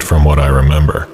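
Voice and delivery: plain reading voice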